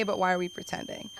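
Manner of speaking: usual speaking voice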